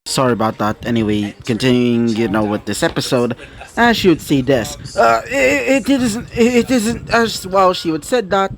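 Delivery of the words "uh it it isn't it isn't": high, stammering protest; flustered denial. A narrator's reading. Caught blushing, denies the kiss.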